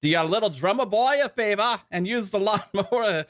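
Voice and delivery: high-pitched mock British ad read, slipping toward Australian; put-on British accent. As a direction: read the sponsor copy in a hammed-up British accent. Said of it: "do it in a British accent".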